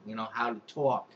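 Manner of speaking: ordinary talk, nothing marked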